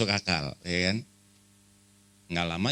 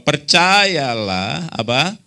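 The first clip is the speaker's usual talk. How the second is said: loud, slow unison scripture reading; preacher leading the verse with the crowd; congregational reading; 8 semitones higher, 12 dB louder, slower than usual. Real, not acted. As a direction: lead the church reading aloud, drawn out. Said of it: "kita baca sama-sama".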